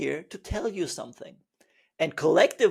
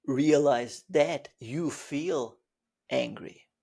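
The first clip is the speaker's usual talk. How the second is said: slow deliberate; 4 semitones lower, slower than usual. Shown as a demonstration. Teacher demonstrating awareness, slow and low. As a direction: lean on each word. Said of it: "You notice the difference?".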